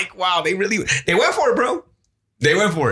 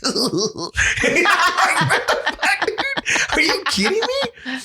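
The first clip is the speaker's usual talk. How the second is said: laughing disbelief; 11 semitones higher, slower than usual. Real, not acted. Reacting to Flair's drinking.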